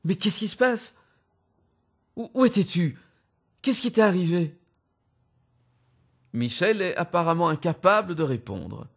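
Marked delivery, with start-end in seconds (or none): 0.0-4.6 voix plus aiguë de l'épouse affolée qui presse de questions; épouse inquiète. Acted. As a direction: jouer Rosemary, pressante et inquiète. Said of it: "Elle le presse de questions". (l'épouse angoissée interroge son mari revenu, voix haute et pressante)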